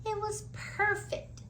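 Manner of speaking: ordinary delivery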